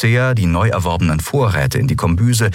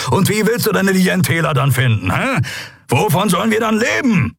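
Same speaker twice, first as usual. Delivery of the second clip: heated, raised-pitch tirade; angry scolding; 9 semitones higher than usual. A narrator's reading. Kero lectures the crew about money, angrily scolding. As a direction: berate them and demand sense. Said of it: "als Kero sie anfuhr".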